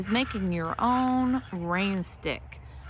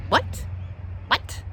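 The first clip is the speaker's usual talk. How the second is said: alert coyote; 5 semitones higher than usual. Shown as a demonstration. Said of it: "So we're gonna be very like".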